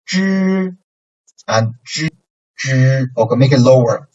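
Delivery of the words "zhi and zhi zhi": zhi starting low, then rising; second tone. Shown as a demonstration.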